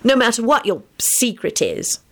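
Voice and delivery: mildly stubborn assurance; adamant. A narrator's reading. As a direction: press the point firmly. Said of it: "she stated adamantly".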